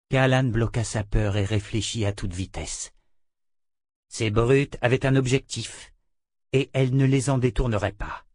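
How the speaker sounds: plain reading voice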